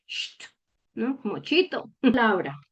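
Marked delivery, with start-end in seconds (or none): 0.1-0.4 soft unvoiced "Sht" like hushing someone; shushing sound. Shown as a demonstration. Teacher hushing a room to show German ST, shushing. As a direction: breathe out a quiet shush. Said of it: "como cuando nosotros queremos decirle a una persona que haga silencio".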